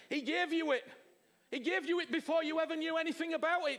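usual speaking voice

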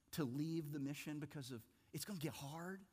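ordinary talk

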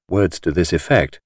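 ordinary delivery

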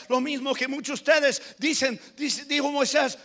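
ordinary delivery

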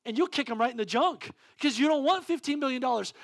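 loud, high fervent sermon voice; full-force preaching. Acted. Preacher telling the congregation about panic, fervent. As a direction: preach the fear with urgency.

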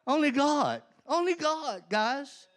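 high, loud, exultant praise. Real, not acted. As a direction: shout thanks to God. Praising God for release.

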